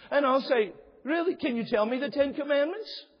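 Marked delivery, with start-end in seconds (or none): 1.0-3.1 challenging disbelief. Retold or imitated. Pastor challenging congregant.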